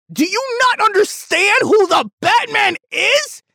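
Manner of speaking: high, loud furious shout; high-pitched angry yelling; outraged shout. Real, not acted. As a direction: yell at the critic in disbelief. Ranting at a critic's review.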